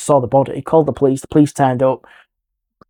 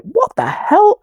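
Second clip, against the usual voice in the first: very high-pitched astonished outburst; shocked disbelief; much slower than usual. Retold or imitated. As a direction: imitate a friend's startled reaction, pitch shooting up. Friend reacts to leg being pulled.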